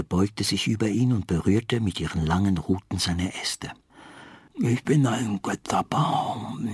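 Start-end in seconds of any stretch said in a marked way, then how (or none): 4.6-6.7 slow, watery rustling voice; wet rustling. A narrator's reading. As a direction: speak as if soaked through, slowly. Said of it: "Das Rauschen klang sehr nass".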